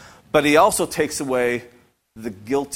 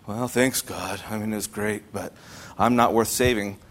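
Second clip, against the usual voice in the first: mopey sigh; 8 semitones lower, 5 dB quieter than usual. Retold or imitated.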